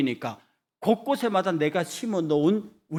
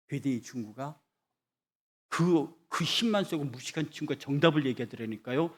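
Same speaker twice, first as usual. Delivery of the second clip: ordinary talk, nothing marked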